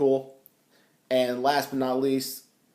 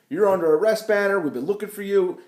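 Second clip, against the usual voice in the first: 1 commanding arrest; 7 semitones higher, 3 dB louder than usual. Acted. Agent arresting Banner.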